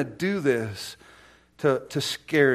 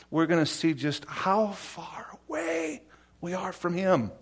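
awed whisper; 5 semitones higher than usual. Real, not acted. Awe at God's distance.

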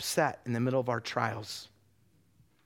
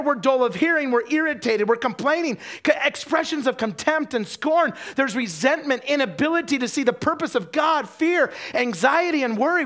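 high, loud, driving sermon delivery; impassioned preacher building to a shout; full-force preaching; 11 semitones higher, 9 dB louder than usual. Real, not acted. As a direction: hammer out the list at full volume.

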